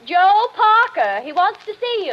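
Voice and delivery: high, loud call across the yard. Acted. Wife calling out to her husband in the summer house, loud. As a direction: shout it out the window to him.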